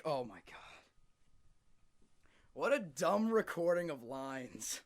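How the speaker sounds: ordinary delivery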